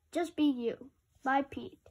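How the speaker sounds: ordinary talk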